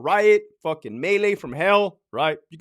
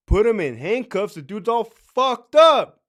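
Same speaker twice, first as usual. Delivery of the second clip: louder, higher disbelief; acted indignant disbelief, building in volume; incredulous outburst; 5 semitones higher, 7 dB louder, slower than usual. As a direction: throw the excuse back loudly, stretching the last words. Acted incredulous reply to officer's excuse.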